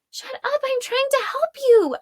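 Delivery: shouting. Acted. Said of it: "Arella shouted".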